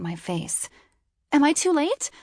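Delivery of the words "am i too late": high, loud panicked screech. A narrator's reading. Late traveller bursting into the office, panicked screech. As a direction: shriek it out, scared you've missed it. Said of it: "I screeched".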